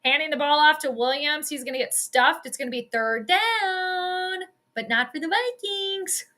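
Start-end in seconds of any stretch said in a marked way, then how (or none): 2.4-6.2 drawn-out, higher sing-song call; playful sing-song. Real, not acted. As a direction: stretch it out high and slow, teasingly. Sing-song taunt at the team.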